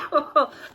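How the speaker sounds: short real laugh. Real, not acted. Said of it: "I can't stop laughing".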